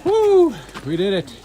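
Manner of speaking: whooping with joy at the top; triumphant shout of relief; excited cheer. Real, not acted. Reaching the summit, cheering.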